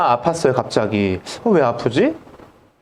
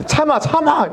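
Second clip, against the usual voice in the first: much higher, urgent command; barked order; 10 semitones higher than usual. Retold or imitated. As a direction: tell him to hold on while you pull. Dentist barks at screaming patient.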